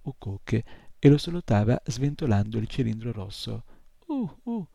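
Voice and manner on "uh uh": high startled squeaky uh-uh; a little creature's high puzzled hum; bewildered squeak. Acted. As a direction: squeak it out, baffled. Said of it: "fece l'incubino sconcertato".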